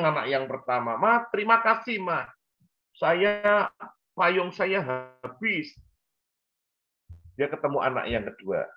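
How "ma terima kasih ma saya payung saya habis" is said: much higher, cheerful son's voice; voiced happy child. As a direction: thank Mom brightly in a child's pitch. Happy son thanks mother.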